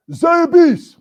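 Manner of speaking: loud, high shouted toast. Retold or imitated. Young lieutenant toasting the general, shouting the word. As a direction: raise the glass and bellow it. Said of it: "So I said".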